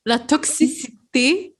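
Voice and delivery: "toxicité" said slowly, syllable by syllable; careful pronunciation. Shown as a demonstration. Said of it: "Répète après moi".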